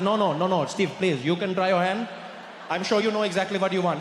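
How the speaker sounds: insistent fake Indian accent; hammed-up Indian accent, urging; put-on Indian accent. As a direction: coax him in an Indian accent. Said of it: "I also couldn't stop".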